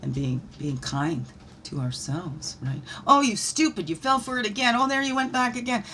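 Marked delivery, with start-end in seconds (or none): 3.1-5.8 high, louder self-berating voice; pitched-up telling-off; mock scolding. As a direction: scold yourself in a raised, mocking voice. Mock self-scolding after a relapse.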